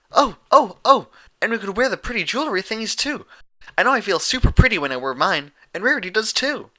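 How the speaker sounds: high, loud and fast excited pony voice; bubbly Pinkie Pie. A narrator's reading. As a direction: babble it out, bouncing with glee.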